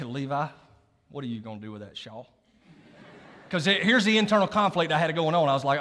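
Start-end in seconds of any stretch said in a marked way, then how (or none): 0.0-2.3 talking to himself. Retold or imitated. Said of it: "I start talking to myself".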